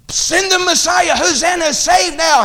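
high, loud pleading cry to God; crowd crying out. Acted. Preacher voicing the crowd's cry, high and loud. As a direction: call it out like the festival crowd.

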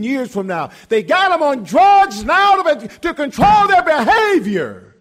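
high, loud fiery preaching; preaching at full force. Real, not acted. Preacher railing at the congregation about kids on drugs, loud and high. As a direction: build the sermon to a shout.

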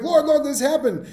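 high, eager mock report; lightly hammed-up excited followers; excited disciples. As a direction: play the thrilled disciples running back. Disciples reporting back to Jesus.

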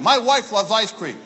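ordinary talk

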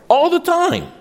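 whole phrase pitched very high; insistent repeat. Real, not acted. Preacher driving home that parents fail, high and insistent. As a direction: push "All the time" right up high for emphasis.

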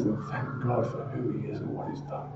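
whispered, soft and low; hushed devotion; reverent whisper. Real, not acted. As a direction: breathe it like a prayer. Reverent aside about thanking God.

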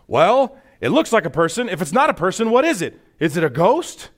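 loud, high, worked-up arguing; concerned disciples. Acted. Disciple arguing back about the figure, acted agitation. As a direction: insist anxiously, pitching it up. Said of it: "Going back and forth and concerned".

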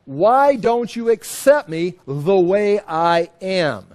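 slow, loud drawn-out plea; mock wailing. Rejected man pleading with God to accept him, mock wailing. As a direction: drag out a put-on wail. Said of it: "he's weeping and wailing".

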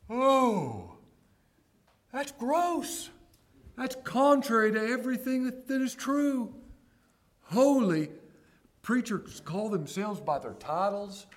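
high-pitched pulpit outrage; indignant preaching. Real, not acted. Preacher objecting to preachers calling themselves reverend, indignant.

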